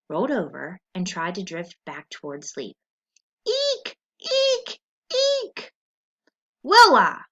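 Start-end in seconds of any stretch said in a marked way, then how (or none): 3.5-5.7 very high shrill squeaks, three in a row; piercing mouse squeals. Acted. Reader squeaking as the trapped mouse, piercing squeals. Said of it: "piercing squeal of a rodent". 6.6-7.2 loud call from across the hall. Acted. Dad calling his daughter across the hall, loud call. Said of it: "Dad called in a weary voice".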